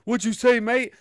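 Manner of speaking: high, loud mock indignation; imitated combative challenge. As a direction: act out a talker squaring up for a row. Talkers squaring up for a row.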